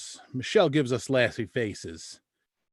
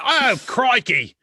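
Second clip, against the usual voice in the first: slow, high mock Australian oh crikey; hammed-up Australian crikey; 8 semitones higher, 7 dB louder, slower than usual. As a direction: ham up an Australian exclaiming crikey. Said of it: "and now we're like".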